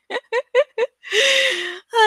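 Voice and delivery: high laugh at own mistake; laughing at flub. Real, not acted. After flubbing the outro line, a high embarrassed laugh. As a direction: crack up at the slip.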